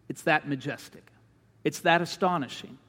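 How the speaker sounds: ordinary talk, nothing marked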